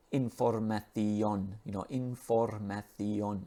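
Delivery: "información" in Spanish, every vowel full and slow; Spanish accent. Retold or imitated. Teacher imitating Spanish learners' vowels, in Spanish accent. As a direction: say "información" slowly as a Spanish speaker would. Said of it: "Spanish students would emphasize every vowel".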